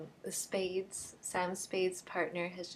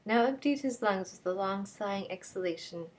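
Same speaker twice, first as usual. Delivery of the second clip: usual speaking voice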